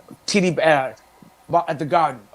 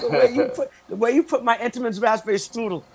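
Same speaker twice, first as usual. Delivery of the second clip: mock swooning high voice; 5 semitones higher than usual. Mock flirt with grocery bagger.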